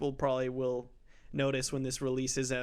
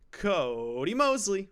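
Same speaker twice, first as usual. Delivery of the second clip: hype announcer; 7 semitones higher, 6 dB louder, slower than usual. Acted. Hyped intro announcing co-host.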